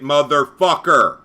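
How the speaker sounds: loud, slow, punched-out curse; angry insult. Real, not acted. Moderator cursing the troll to cohost, loud and slow. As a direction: spit the insult out. Insulting absent opponent mid-rant.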